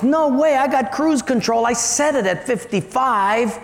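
mock indignant denial; indignant driver. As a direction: argue back at the traffic cop. Driver argues with policeman in joke.

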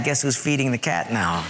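ordinary talk

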